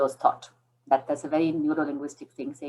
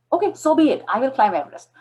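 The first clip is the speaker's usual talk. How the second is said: defiant vow; 10 semitones higher, 6 dB louder than usual. Retold or imitated. Retold bold vow.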